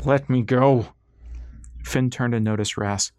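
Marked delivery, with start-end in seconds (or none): 0.0-0.9 louder, forceful demand; struggling protest. A narrator's reading. Patient wrestling two orderlies, loud struggling protest. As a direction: push it out against restraint. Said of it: "He wrestled against the two men".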